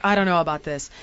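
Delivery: low, uneasy mumble to yourself; doubtful aside. Retold or imitated. Wife retelling her doubts as Grandma boards the kayak, doubtful.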